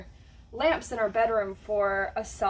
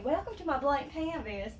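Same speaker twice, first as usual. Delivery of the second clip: southern accent with stretched vowels; higher-pitched put-on southern welcome; 4 semitones higher than usual. Shown as a demonstration. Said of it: "in my southern accent".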